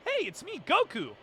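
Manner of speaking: high, bright Goku greeting, dropping at the end; Goku impression. Shown as a demonstration. A voice actor imitating Goku's classic greeting. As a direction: chirp it like a cheerful hero. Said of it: "the way Sean Schemmel does Goku".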